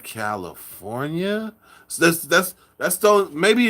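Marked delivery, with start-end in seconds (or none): 0.0-1.5 soft, slow CALIFORNIA, lower and rising at the end; imitating awed reaction. People elsewhere hearing you're from California, hushed awed echo. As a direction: draw CALIFORNIA out low and questioning, as if awed. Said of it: "evokes that type of expression".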